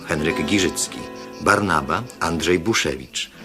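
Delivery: usual speaking voice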